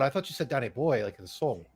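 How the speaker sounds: usual speaking voice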